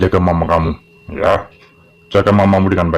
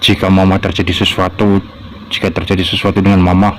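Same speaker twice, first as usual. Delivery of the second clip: reflective thinking-aloud voice; inner monologue. Acted. Child alone wondering about Papa's words, reflective inner voice. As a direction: muse to yourself, worried.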